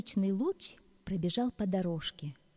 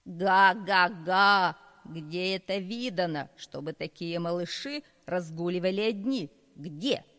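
angry goose; 6 dB louder than usual. Acted. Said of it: "сердито посмотрел".

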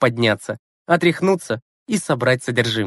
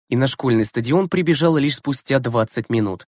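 ordinary delivery

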